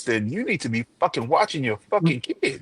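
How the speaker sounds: exasperated, building to loud and high; indignant telling-off, swelling at the end; heated retelling. Retold or imitated. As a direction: let the scolding rise to a loud finish. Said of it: "didn't have to say it like that".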